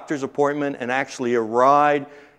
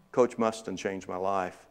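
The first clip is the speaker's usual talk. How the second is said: slow heartfelt tribute; 4 semitones lower, 8 dB quieter than usual. Real, not acted.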